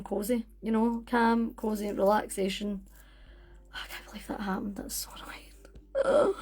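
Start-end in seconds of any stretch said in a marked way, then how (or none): 3.7-6.3 hushed, exasperated aside; whispered lament over a mishap; frustrated whisper. Real, not acted. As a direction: whisper it to yourself in real annoyance.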